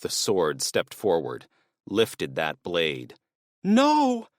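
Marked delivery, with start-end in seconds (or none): 3.6-4.3 much higher and louder, sudden cry; horrified scream No. Acted. Susan screaming as the sword is raised. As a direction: scream as the blade comes down.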